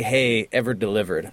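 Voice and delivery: usual speaking voice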